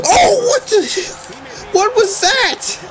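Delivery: very high, slow, drawn-out laughing exclamation; laughing disbelief. Real, not acted. Gamer laughing slowly in shock at the reversal.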